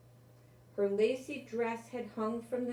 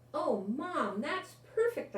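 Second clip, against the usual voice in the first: delighted; 6 semitones higher than usual. Acted. Child's delighted reply to mom.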